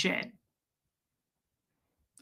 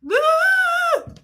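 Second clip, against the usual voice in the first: high shrill-free whoop, much higher and louder; big mocking woo; 6 dB louder than usual. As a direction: cheer like you finally cracked. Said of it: "They wanna see me go".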